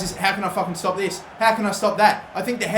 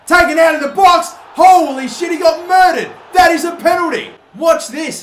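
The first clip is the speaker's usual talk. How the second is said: loud, high yelling about the foul; excited shout; 10 semitones higher, 10 dB louder than usual. Real, not acted. Gamer yelling as his winger is taken down, excited shout. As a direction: shout it out, demanding the penalty. His player fouled in box.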